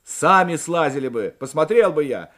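loud, joking comeback; bantering retort. A narrator's reading. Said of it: "в тон приятелям ответил Морев". (шутливо, в тон приятелям)

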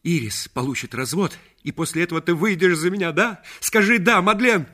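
loud, high, urgent pleading; ardent proposal. Acted. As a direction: press for a yes, building to a cry. (страстно, настойчиво умоляя)

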